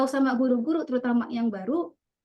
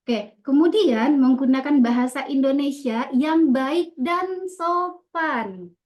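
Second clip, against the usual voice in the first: slow, louder, dan sopan drawn out; emphatic rule; 4 dB louder, slower than usual. Shown as a demonstration. Teacher stating the polite-speech rule to pupils, slow and emphatic. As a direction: stress baik and sopan slowly. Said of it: "Diberikan penekanan-penekanan".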